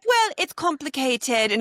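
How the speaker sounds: high, slow posh British drawl; posh British accent. Pretend. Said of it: "for a posh accent".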